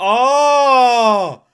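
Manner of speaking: loud, high crying-out "oh"; stunned cry at the screen; shocked. Real, not acted. Reacting to a shocking scene.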